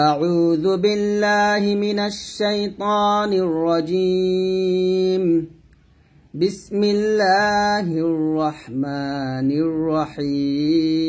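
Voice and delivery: slow, drawn-out melodic tajweed chant; slow Quranic recitation. Shown as a demonstration. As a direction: recite it slowly and reverently, stretching each vowel.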